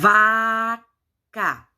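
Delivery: "vaca" with a long held vaaa, then ca; syllable by syllable. Shown as a demonstration. Teacher introduces the drawing's word to children, slow syllables.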